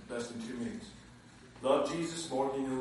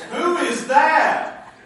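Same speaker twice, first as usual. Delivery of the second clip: laughing; 10 semitones higher, 10 dB louder, much slower than usual. Retold or imitated. Mock voice of surprised heaven.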